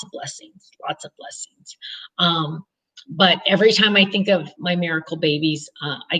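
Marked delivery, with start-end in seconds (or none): 0.0-1.8 soft whispered echo. Real, not acted. Counting her blessings to viewers, a soft whisper. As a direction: breathe the words as an aside.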